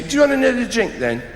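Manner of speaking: high-pitched mock English voice; an English accent as if about to die at any moment; English voice, near death. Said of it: "in that English voice that suggests they're just about to die".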